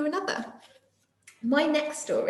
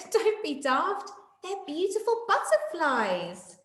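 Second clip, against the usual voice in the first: slow, higher teasing retort; mock laughing; 7 semitones higher, slower than usual. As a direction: tease a friend kindly. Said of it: "Day Monkey laughed and said".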